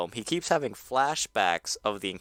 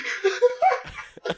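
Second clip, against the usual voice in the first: very high squealing laugh; 4 dB louder than usual. Real, not acted. Big laugh.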